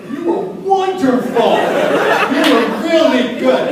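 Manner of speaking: slow, loud, high-pitched compliment; exaggerated overpraise. Greeting a blind audience member, slow overdone praise. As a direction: spell out praise with hammed-up emphasis. Mimics addressing a blind person.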